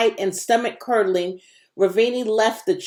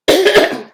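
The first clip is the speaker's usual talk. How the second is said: gagging cough; 11 semitones higher, 9 dB louder than usual. Acted. Ravini gagging after slaughterhouse.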